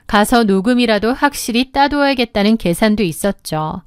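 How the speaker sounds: ordinary delivery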